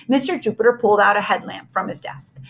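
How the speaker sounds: ordinary delivery, nothing marked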